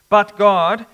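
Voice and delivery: "But God," with a loud punched "But"; emphatic but God. Shown as a demonstration. Preacher reading verse four, loud emphatic stress. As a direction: hit "But" hard, like a turning point. Sermon reading the key contrast.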